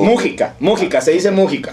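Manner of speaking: "Mújica" with the first syllable stressed. Shown as a demonstration. Host correcting cohost on the surname, "Mújica" stressed on MÚ. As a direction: hit the MÚ in "Mújica", not the second syllable. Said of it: "Acento en la U".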